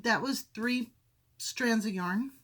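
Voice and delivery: usual speaking voice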